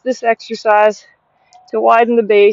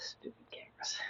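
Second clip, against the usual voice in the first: whispered aside; 32 dB quieter than usual. Real, not acted. Muttering at the camera.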